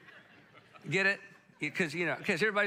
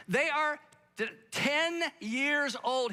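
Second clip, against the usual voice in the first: higher, drawn-out, exasperated stress on ten; incredulous emphasis; 6 semitones higher, slower than usual. Real, not acted. Outraged at dad's behaviour.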